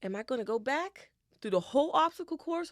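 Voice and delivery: high, loud incredulous question; dismayed self-questioning. Retold or imitated. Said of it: "I'm thinking to myself in the dream".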